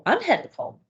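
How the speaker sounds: anxious mom repeating the caregiver's words; mom echoing. Retold or imitated. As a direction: parrot it back like an anxious mom. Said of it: "And they go".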